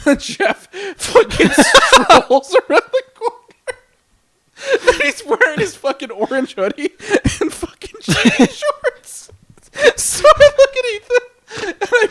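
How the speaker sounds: high, squeaky laughing speech; helpless laughter. Real, not acted. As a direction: barely get the words out for laughing. Cracking up while telling the story.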